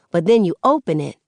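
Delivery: plain reading voice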